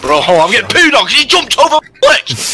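high, loud, fast complaining; exasperated yelling at a teammate; frustrated shout. Real, not acted. Teammate jumped over him.

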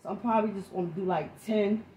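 ordinary talk

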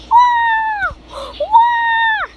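high startled wah yelp. Real, not acted. Character falls in game.

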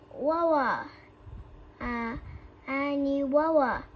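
loud, mangled "Wawa" for water; burned tongue. A narrator's reading. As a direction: yell "Wawa" like your tongue is on fire. Tongue burned by hot sauce.

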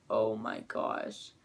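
low, slow voiceless sigh of relief; drained whisper. Retold or imitated. Kyle to friends after being caught, low breathy whisper. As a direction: let it out low and slow like you barely survived.

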